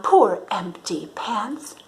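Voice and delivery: usual speaking voice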